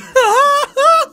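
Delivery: sudden loud high burst of laughter. Real, not acted. Laughing hard at his own joke, a loud high burst of laughter. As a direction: crack up loudly.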